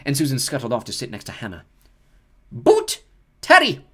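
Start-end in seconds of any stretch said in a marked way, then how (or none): 2.5-3.9 loud, high name call. Acted. McGonagall calls a pupil forward, loud and high. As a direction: call the name across the hall. Reading names to the hall.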